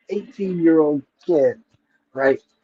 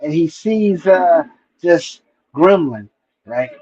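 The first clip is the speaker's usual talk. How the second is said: ordinary talk, nothing marked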